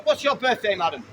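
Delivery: ordinary delivery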